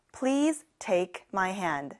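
very slow, carefully spaced English; slow classroom demo; slow model sentence. Shown as a demonstration. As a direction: say it slowly for learners to repeat. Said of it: "같이 해볼까요?".

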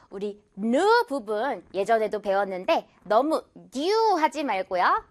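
ordinary talk

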